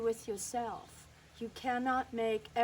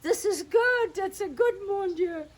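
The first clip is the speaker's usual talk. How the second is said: much higher, louder exclamation in a put-on old French voice; hammed-up grandmother crying mon Dieu; 9 semitones higher, 8 dB louder, slower than usual. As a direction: throw up your hands like an excited French grandmother. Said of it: "and she went".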